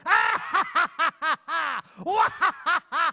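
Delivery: high, loud cackling villain with a shout; evil villain laugh. Shown as a demonstration. Host demonstrating the villain part to a volunteer, evil cackle. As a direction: cackle then snarl the threat like a pantomime baddie. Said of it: "you take a big step forward and you go".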